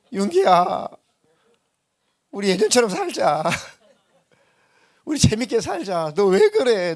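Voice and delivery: loud, high, hammed-up tearful plea; tearful pleading. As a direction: beg him to come back, voice cracking. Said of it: "막 울었대요". (친구가 울면서 예전처럼 살자고 애원하는 흉내)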